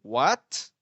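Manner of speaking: "What?" with a rising tune; "What?" demoing the rising tone. Shown as a demonstration. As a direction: glide up on "What?". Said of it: "It give a second tone".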